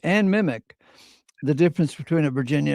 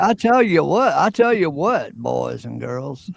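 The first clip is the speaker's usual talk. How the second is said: higher, swooping Southern cartoon rooster; Foghorn Leghorn; 4 semitones higher, 4 dB louder than usual. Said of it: "We actually call him Governor Leghorn".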